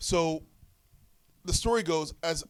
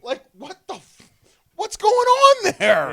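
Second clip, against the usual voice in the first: very high, slow, loud disbelief; baffled shout; 16 dB louder, slower than usual. Retold or imitated. Wrestler baffled by the ref's lace, high and loud. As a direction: stretch it out in outraged surprise.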